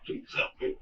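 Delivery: a hushed, breathy retold begging; whispered plea. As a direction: whisper the plea like you've nothing left. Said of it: "I was begging. Begging".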